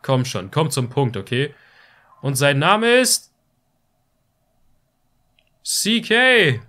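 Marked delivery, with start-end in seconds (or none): 2.2-6.6 dramatic announcer. Acted. Announcing the hero's name.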